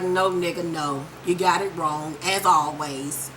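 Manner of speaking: deep, unbothered Angela; low dismissive Angela voice. Retold or imitated. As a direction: brush him off in a low voice. Voicing Angela brushing Greg off.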